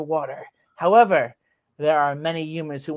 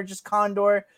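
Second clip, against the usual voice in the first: ordinary talk, nothing marked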